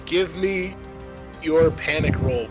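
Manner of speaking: ordinary talk, nothing marked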